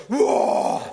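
loud, breathy, unvoiced mock war cry; running at an intruder and yelling whoa; startled yell. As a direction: blurt out a sudden scare-off yell. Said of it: "I've yelled as loud as I can".

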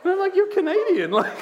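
high, breathless laughter through the words; laughing hard. Real, not acted. Pastor teasing his Canadian friend, laughing high. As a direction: laugh while teasing "for Greece".

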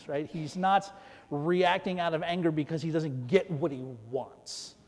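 normal talking voice

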